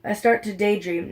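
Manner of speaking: usual speaking voice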